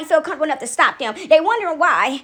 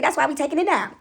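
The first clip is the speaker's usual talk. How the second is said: ordinary delivery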